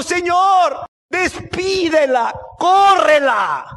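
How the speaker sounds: high, loud, drawn-out demand; acted fed-up outcry; exasperated begging. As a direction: plead at full voice, stretching the words. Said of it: "le rogaron diciendo".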